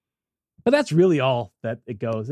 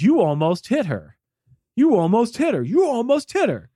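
each repeat climbing higher; imitating an angry man getting shriller; angry repeated accusation; 9 semitones higher, 3 dB louder than usual. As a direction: hammer the same accusation, rising every time. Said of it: "He's just repeating himself".

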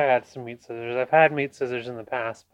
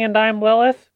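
mildly raised mock version of her catchphrase; imitating Lilith's intro; 7 semitones higher than usual. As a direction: echo her intro line, just a little higher. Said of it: "that you're saying".

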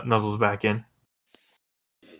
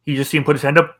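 ordinary talk

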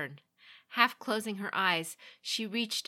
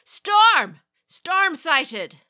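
loud, high warning shout; lookout shouting; 11 semitones higher, 14 dB louder, much slower than usual. A narrator's reading. Lookout shouting a storm warning to the crew. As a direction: bellow it across the deck. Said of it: "A shout from the lookout".

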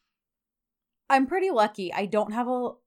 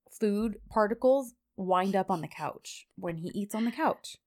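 ordinary talk, nothing marked

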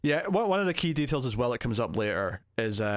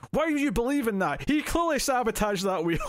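high-pitched, incredulous protest at a film character; exasperated disbelief; 11 semitones higher than usual. Retold or imitated. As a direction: protest it in mock outrage, voice pitched right up. Incredulous reaction to the film scene.

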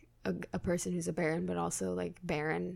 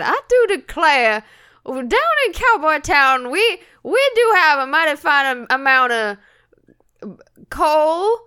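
higher, louder hammed-up old prospector; stupid prospector voice; 6 semitones higher, 7 dB louder than usual. Host doing a stupid prospector voice for the bit, hammed-up and loud. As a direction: ham up a silly gold-rush prospector. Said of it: "do a stupid prospector voice".

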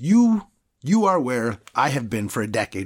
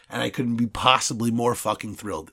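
ordinary talk, nothing marked

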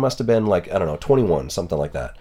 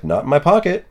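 higher and a bit louder, a knowing reply; a lightly hammed-up reply to the guard; mock pointed reply; 8 semitones higher, 4 dB louder than usual. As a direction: say it pitched up with a wink. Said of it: "And I was like".

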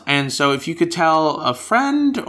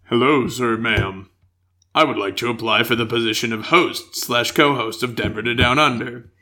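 lower-pitched, measured formal read; formal letter reading; 5 semitones lower than usual. Acted. Host reading a listener's application to be co-host, mock-formal. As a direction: read it out like a solemn job application.

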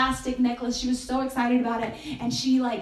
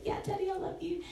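put-on higher, small child's reply, softer; daughter's higher I love you at bedtime; little girl voice; 8 semitones higher, 8 dB quieter than usual. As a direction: answer like a sweet young daughter. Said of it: "And she said".

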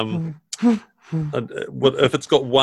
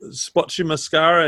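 ordinary delivery

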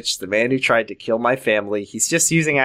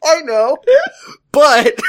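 high, loud hammed-up wail; mock sobbing; 14 semitones higher, 6 dB louder, slower than usual. Crying sister defending her boyfriend, high mock sobbing. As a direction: wail it through fake tears.

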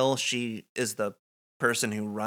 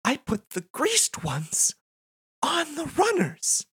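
Nadine voice; 11 semitones higher than usual. Acted. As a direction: build up to a high excited reveal. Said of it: "she says".

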